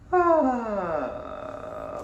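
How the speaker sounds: high sigh falling into creaky fry; creaky vocal fry warmup. Shown as a demonstration. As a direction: glide from head voice to fry. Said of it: "head voice into a Vocal Fry chest voice".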